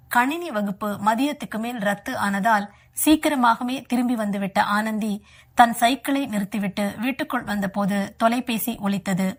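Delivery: ordinary delivery, nothing marked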